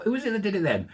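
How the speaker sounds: ordinary talk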